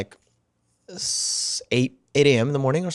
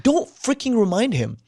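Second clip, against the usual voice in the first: angry; 11 semitones higher than usual. Retold or imitated. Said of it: "I was like".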